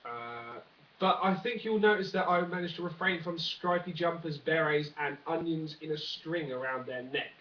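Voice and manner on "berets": normal talking voice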